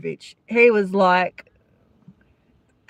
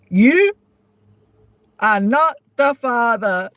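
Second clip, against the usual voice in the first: Maury Povich. Retold or imitated. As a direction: announce the result like a TV host. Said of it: "Maury Povich's voice".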